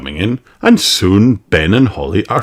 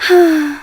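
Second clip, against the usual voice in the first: sigh; 3 dB louder than usual. Acted. Old elf laments lost treasure.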